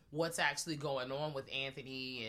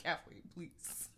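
hushed plea; 8 dB quieter, much slower than usual. Retold or imitated. Partner hushing her in theater.

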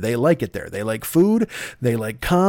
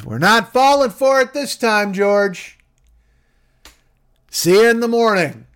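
high, louder, dismissive call from afar; villager calling from house; 9 semitones higher, 4 dB louder than usual. Acted. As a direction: brush him off from indoors.

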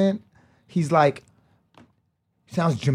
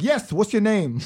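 higher, insistent retold demand; impatient prompting; 6 semitones higher, slower than usual. Seller prodding a hesitant customer, impatient. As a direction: press the caller for the answer.

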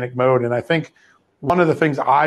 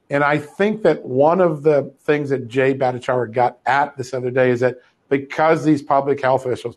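usual speaking voice

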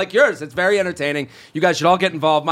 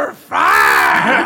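mock shout; 4 dB louder than usual. Joking boss line.

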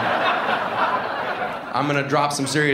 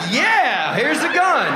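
eager shout; 13 semitones higher, 4 dB louder, slower than usual. Retold or imitated. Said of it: "he's like".

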